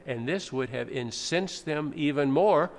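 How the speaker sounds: normal talking voice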